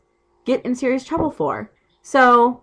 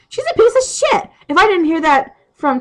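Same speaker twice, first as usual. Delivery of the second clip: furious outburst; 8 semitones higher, 4 dB louder than usual. Real, not acted. Venting fury about friend.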